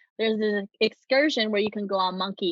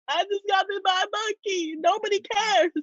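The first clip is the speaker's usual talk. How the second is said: mock self-pity; 9 semitones higher than usual.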